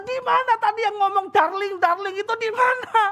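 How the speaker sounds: a hammed-up husband hunting for the one who said darling; mock angry outburst. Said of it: "langsung saya langsung". (suami pura-pura marah, suara tinggi)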